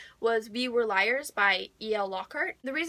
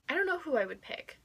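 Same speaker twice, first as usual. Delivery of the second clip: ordinary delivery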